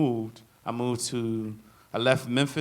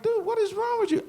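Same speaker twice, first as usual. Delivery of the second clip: much higher, exasperated; incredulous. Retold or imitated. Patient retelling the alarmed doctor's outburst, pitched way up. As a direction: squeal it in disbelief. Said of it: "I'm like".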